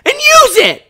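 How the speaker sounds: shrieking high and loud at her, furious; angry yell. Retold or imitated. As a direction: yell it down the phone in a rage. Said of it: "Like, I was so angry".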